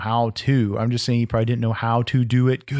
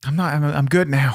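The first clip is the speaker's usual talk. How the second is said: usual speaking voice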